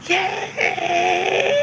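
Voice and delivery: high cheer; a quick high cheer of joy; excited yay. Real, not acted. Counting down to graduation, high happy cheer.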